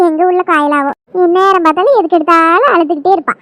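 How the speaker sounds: loud, heated sibling squabble; sisters quarrelling. Acted. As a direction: shout and taunt your little sister. Two sisters quarrel loudly. (தங்கையுடன் சண்டையிடும் உரத்த கோபக் குரல்)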